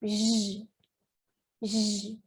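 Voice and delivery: "J" as a soft, held buzzing zh; Portuguese J sound. Shown as a demonstration. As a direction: hold the sound "J" alone, softly, like zh. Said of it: "bem parecido ao português".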